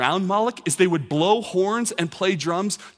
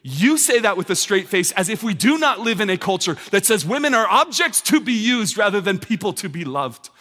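preaching at full force; 4 semitones higher than usual. Real, not acted. Pastor confronting the congregation, building preacher's fervour. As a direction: hammer each point home.

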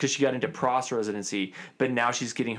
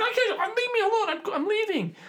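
very high, shrill-pitched mock scream; mock screaming patient. Patient screaming to leave the dentist chair, high mock wail. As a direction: squeal it like a panicked patient in the chair. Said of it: "the girl's screaming and screaming".

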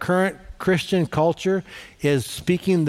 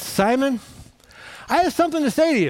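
Jesus confronting Simon; 8 semitones higher, faster than usual. Acted. Jesus addressing Simon at dinner.

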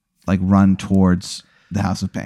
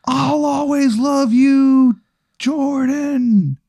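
very high, drawn-out falsetto wail; mock dying yell; much slower than usual. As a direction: wail a slow, hammed-up farewell. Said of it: "you hear him yell".

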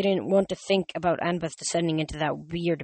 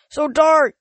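yelled; 10 semitones higher, 11 dB louder than usual. A narrator's reading. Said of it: "Chris yelled".

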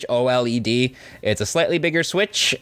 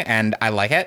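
ordinary talk, nothing marked

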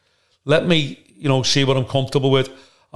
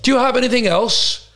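Brian Badondi; 9 semitones higher than usual. Brian Badondi insisting to the manager, high and grandiose. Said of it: "And he goes".

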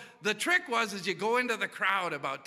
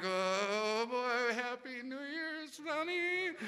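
sheepish greeting; 3 semitones higher, 6 dB quieter, much slower than usual. Retold or imitated. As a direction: mumble it guiltily past your wife. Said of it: "I'm just going like".

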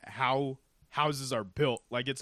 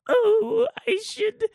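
put-on high, slow, trembling old woman; old lady voice; 7 dB louder, slower than usual. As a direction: squeak it out like a frightened granny. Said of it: "an old lady voice like what you just did".

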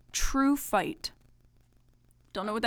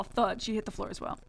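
plain reading voice

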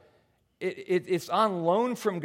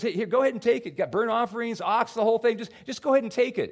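eager giving; 8 semitones higher, 6 dB louder than usual. Retold or imitated. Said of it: "He goes".

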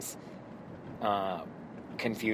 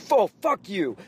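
loud, high brush-off; dismissive anger; 8 semitones higher, 7 dB louder than usual. Retold or imitated. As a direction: spit it back at me. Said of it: "They're like".

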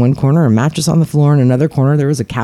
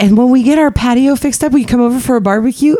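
eager invitation; 12 semitones higher, faster than usual. Retold or imitated. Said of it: "they're like".

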